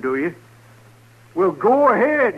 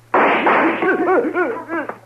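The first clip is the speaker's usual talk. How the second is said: a high, reckless laugh; menacing laugh; 9 semitones higher than usual. Acted. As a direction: cackle as he goes for his gun. Outlaw laughs before drawing.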